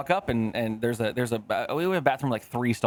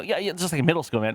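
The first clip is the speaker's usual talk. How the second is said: ordinary talk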